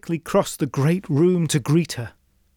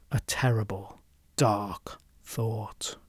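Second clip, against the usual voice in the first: whispered, low and much softer; ominous whisper; 6 semitones lower, 8 dB quieter, slower than usual. A narrator's reading. Narrator revealing a dark thought, low whisper. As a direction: let the dread creep in.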